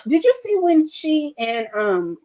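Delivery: normal talking voice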